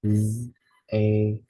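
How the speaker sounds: "Z-A-T-A" as zz, ay, t, ah; sounding out. Shown as a demonstration. Teacher sounding out zeta, separate letter sounds. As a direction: sound out "Z-A-T-A" with a buzzed z. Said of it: "sound it out".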